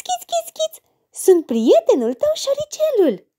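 very high, squeaky little mouse voice; high mouse chirping to the girl. Acted. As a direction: squeak it like a tiny friendly mouse. Mouse character keeps talking.

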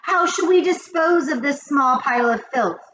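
high witchy cry of disgust; witches crying out. A narrator's reading. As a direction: call it out eagerly as a witch. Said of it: "they cried out".

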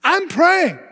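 urgent high-voiced plea, stretching the last words; crying out. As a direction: pitch it high and insistent, phrase by phrase. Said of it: "crying out".

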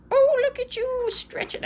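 high, slow coo to the cat. Real, not acted. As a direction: gush over him slowly and high. Cooing at stretching cat.

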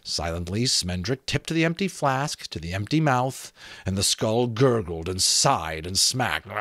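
plain reading voice

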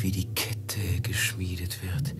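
ominous whisper. Acted. Master whispering to Velid about the tightening chain.

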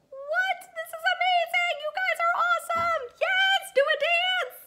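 very high, loud ecstatic squeal. Acted. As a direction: squeal with pure delight. Wild celebration of reading.

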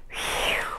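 quiet, blown-out breath; soft "whew". A narrator's reading. Ava calms herself before comforting mother, soft exhale. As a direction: exhale softly to settle down. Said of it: "let out a deep breath".

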